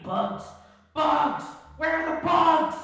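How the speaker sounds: building from mutter to loud yell; frantic shout. Acted. Kyle digging under his bed yelling for bugs. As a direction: demand the bugs, growing wild.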